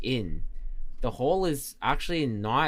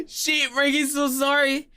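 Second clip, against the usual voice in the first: high, squealed apology through laughter; laughing apology; 12 semitones higher than usual. Real, not acted. Streamer sorry about viewer's changed name, high and laughing. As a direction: apologise while cracking up.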